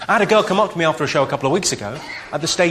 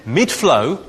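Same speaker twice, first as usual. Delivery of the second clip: usual speaking voice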